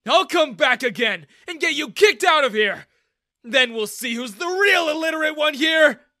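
loud, high, defiant shouting; yelling. A narrator's reading. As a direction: shout threats while being dragged away. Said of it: "I yelled at Daniel".

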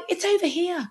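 high, bright call of discovery; excited discoverer. Retold or imitated. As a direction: announce the find, pitched well up. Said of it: "And I'm like".

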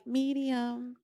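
very soft, sheepish little order; meek order. Retold or imitated. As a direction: order it timidly, almost under your breath. Acts out timid steak order.

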